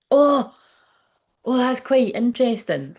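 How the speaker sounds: high, loud sudden oh; surprised. Real, not acted. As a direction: caught off guard, intrigued. Surprised by the card.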